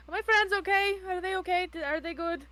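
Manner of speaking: high, anxious string of questions; worried questioning. Acted. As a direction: check urgently that your friends are safe. Character anxiously asks after friends.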